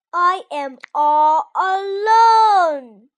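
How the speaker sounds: very loud, drawn-out storybook wail; long, high, full-volume cry; scream. A narrator's reading. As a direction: scream it slowly, stretching the words high and loud. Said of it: "screamed Toad".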